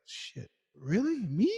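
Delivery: mock-stunned, drawn out and rising; taken aback, stretching it into a question; slow incredulous questioning; retold disbelief. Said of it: "I was like".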